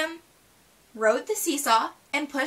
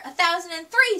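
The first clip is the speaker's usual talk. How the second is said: louder, higher competitive snap; snapped; 6 semitones higher, 5 dB louder than usual. A narrator's reading. Lizzie topping Sister's count, snapping louder and higher. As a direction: bite the number back to win. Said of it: "snapped Lizzie".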